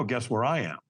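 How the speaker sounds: ordinary delivery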